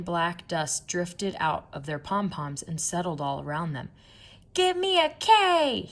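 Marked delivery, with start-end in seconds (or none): 4.5-5.9 high, loud, slow cheerleader chant; cheerleader shout. A narrator's reading. Cheerleaders rousing the crowd, loud high chant. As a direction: belt it out like a cheerleader on the field. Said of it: "shouted the cheerleaders".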